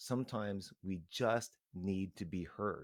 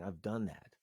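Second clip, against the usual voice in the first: usual speaking voice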